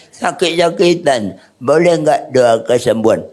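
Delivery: put-on comic elderly pastor voice; mock-feeble old-timer impression; retired old pastor. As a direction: imitate an ailing old man asking a favour. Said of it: "Ada pendeta yang sudah pensiun".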